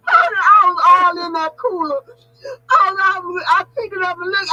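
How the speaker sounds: cracking up retelling the grocery store moment; laughing retelling. Real, not acted. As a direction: keep laughing while you tell it.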